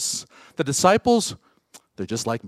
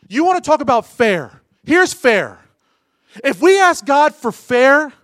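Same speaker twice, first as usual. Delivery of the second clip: higher, louder, emphatic declarations; full-force preaching; 6 semitones higher, 10 dB louder than usual. Acted. Preacher declaring that no one deserves grace, loud and high. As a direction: proclaim it like a revival.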